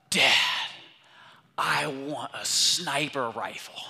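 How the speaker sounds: loud burst, then slow, drawn-out wish; mock a kid's big awakening, slow and emphatic; awestruck son. Son spots gun scopes in a magazine, awestruck. Son's awed request to dad.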